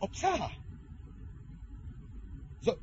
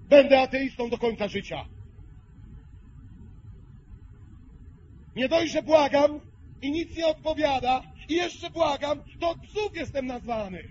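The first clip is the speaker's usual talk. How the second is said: angry outburst; 6 semitones higher than usual. Pretend. Imagined angry vow of atheism.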